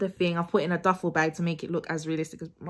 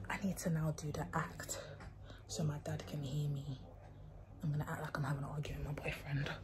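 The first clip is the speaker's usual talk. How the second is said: hushed whispered aside to the camera; soft conspiratorial whisper; secretive whisper; 5 semitones lower, 15 dB quieter, faster than usual. Real, not acted. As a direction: whisper it so dad can't hear. Secret aside before staging prank.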